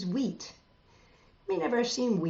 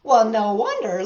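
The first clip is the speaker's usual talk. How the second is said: plain reading voice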